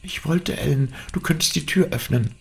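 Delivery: high, thin, hushed voice; timid stage whisper, very high; anxious whisper. A narrator's reading. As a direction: whisper back anxiously in a high girlish pitch. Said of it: "flüsterte sie ängstlich zurück".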